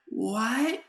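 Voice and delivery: high, rising disbelief; a sharp high what as the homer flies; stunned what. Retold or imitated. Friend stunned as the dad kills the TV mid-homer, high disbelief.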